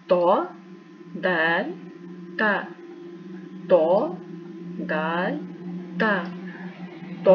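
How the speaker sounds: letter drill; thin and thick letters. Shown as a demonstration. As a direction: contrast thin ता with heavy तो. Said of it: "जो तो है ये मोटा बोला जाएगा".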